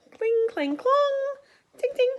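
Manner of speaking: high, loud metal clang sounds; clashing swords. Acted. The knights' swords clashing in battle, high loud clangs.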